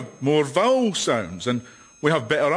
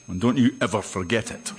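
low-pitched mock threat; mock menace; 9 semitones lower, 3 dB quieter, faster than usual. Scot insisting the accent is reassuring, low mock menace. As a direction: drop low for a veiled warning.

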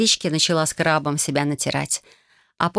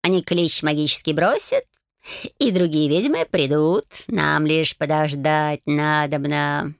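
slow purring cat voice; 2 semitones lower, slower than usual. Acted. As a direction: drawl it like a smug old cat. Cat speaks.

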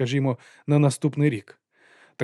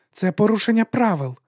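high-pitched, pointed rebuke; hissed objection; 9 semitones higher, 4 dB louder than usual. A narrator's reading. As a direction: hiss the objection in a raised pitch. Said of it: "просичала вона".

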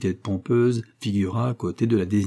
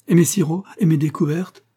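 higher, louder, rising complaint; indignant protest; 7 semitones higher, 5 dB louder than usual. Acted. Butler defending his syrups to the engineer, indignant. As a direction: protest as if his life's work is threatened. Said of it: "protesta le majordome".

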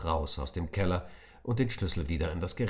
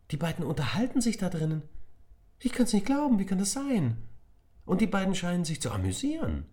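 much higher, louder disbelief; can't believe your ears; astonished; 13 semitones higher, 3 dB louder than usual. Acted. Nick tells Emir what he hears, astonished.

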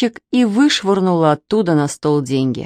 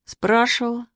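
raised, subdued one-word admission; higher-pitched, grudging reply; reluctant; 5 semitones higher, 4 dB quieter than usual. A narrator's reading. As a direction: admit it unwillingly. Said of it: "неохотно сказал Костя".

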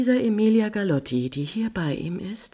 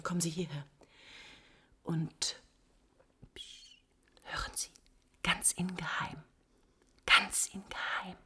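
soft, hushed whisper; conspiratorial whisper, almost silent; secretive whisper; 10 dB quieter than usual. Acted. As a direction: whisper it close to his ear. Countess draws him close secretly.

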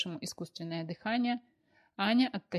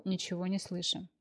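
plain reading voice

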